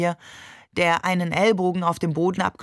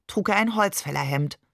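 ordinary delivery

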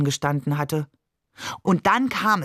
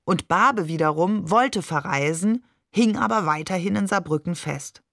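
usual speaking voice

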